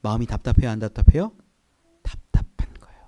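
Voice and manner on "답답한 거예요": whisper. Real, not acted.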